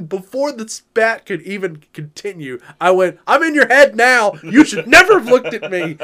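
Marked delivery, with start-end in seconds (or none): 3.3-6.0 higher, louder laughing jab; gloating taunt. Retold or imitated. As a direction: rub it in with a grin. Taunting the umpire after glare.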